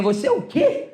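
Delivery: high-pitched incredulous question; mock astonishment, voice leaping up; retold disbelief. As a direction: imitate someone utterly taken aback. Me olhou como se eu fosse o E T de Varginha.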